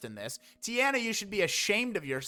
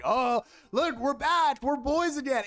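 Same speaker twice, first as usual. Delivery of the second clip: mocking reunion; 5 semitones higher, 4 dB louder than usual.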